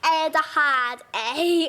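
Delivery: slow, badly faked British accent; British attempt sliding into southern twang; best British attempt. Shown as a demonstration. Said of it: "I'll try it in my best British".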